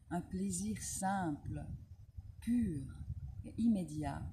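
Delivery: measured Proust reading, pausing between phrases; poised recital with spaced-out words; literary reading aloud. A narrator's reading. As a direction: read it out phrase by phrase, savouring each. Reads a Proust extract aloud.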